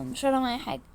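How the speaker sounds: normal talking voice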